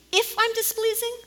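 high incredulous mock of Balaam's words; scornful preacher mocking a weak apology; mocking quote. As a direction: echo his words back, high and disbelieving.

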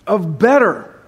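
usual speaking voice

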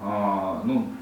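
ordinary delivery

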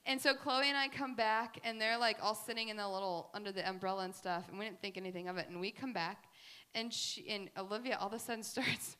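usual speaking voice

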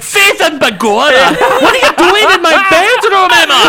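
high, loud hammed-up stage-Irish leprechaun voice, laughing through it; mock Irish accent. Banshee waking to Emma in his bedroom, hammy mock Irish accent. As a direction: overdo a cartoon Irish accent, mocking Banshee. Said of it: "Banshee sounding like the Lucky Charms leprechaun".

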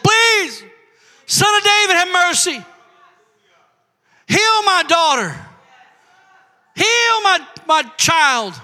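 high mock plea to Jesus; pleading mother. Mother begging Jesus in story.

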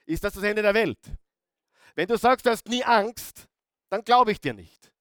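normal talking voice

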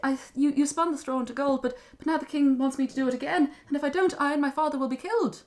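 high, loud anxious explaining; acted frantic girl; distressed young woman. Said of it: "the young woman said".

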